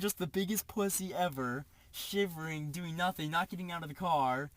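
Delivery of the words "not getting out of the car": usual speaking voice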